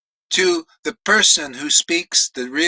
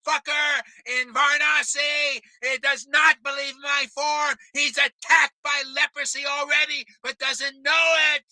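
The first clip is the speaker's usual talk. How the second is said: angry Lord Chaitanya; 8 semitones higher than usual. Acted. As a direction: play the god enraged at the liar. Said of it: "And Lord Chaitanya got angry".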